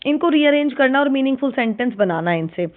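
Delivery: ordinary talk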